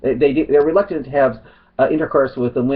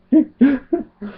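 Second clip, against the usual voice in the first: high giggle; short burst of high-pitched laughter; real laugh; 11 semitones higher than usual. Real, not acted. Vlogger finding the accusations turned round funny, high laugh.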